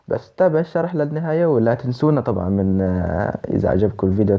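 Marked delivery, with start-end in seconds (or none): none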